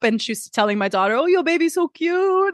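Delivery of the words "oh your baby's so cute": high, cooing imitation of a Portuguese accent. Housekeeper tells the daughter about the baby doll in a high Portuguese accent. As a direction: mimic the Portuguese housekeeper sweetly, pitched up. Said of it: "she's doing it with an accent".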